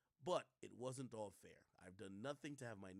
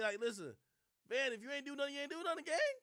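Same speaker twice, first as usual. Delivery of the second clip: much higher, loud exasperated plea; loud pleading rant; 10 dB louder than usual. Real, not acted. Streamer shouting advice at Van, loud pleading rant. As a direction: yell at a friend to stop apologizing.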